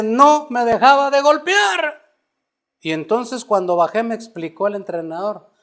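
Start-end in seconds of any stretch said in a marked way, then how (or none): 0.0-2.0 louder, higher, slower refrain; building to the climax of the story; emphatic climax. Retold or imitated. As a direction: hammer the line as the fight turns.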